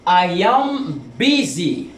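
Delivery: "I am busy" said slowly, word by word; slow model phrase. Shown as a demonstration. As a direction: slowly lay out the correct phrase. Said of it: "on dit plutôt". (lentement : "I am busy")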